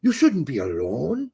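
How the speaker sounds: badly faked Jamaican accent drifting toward French. Stranger chatting up the wife on the beach, bad Jamaican accent. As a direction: flatter her in a shaky attempt at Jamaican. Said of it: "That was my Jamaican accent".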